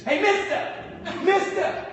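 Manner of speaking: put-on high, loud child's call; hammed-up toddler shouting mister; little girl calling. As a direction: imitate a three-year-old calling the flight attendant. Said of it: "Three years old".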